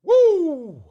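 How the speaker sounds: excited whoop; a loud, high cheer of pride. Real, not acted. Boasting about hours played, loud high whoop.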